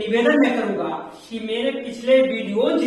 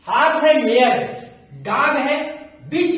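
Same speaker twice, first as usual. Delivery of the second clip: ordinary delivery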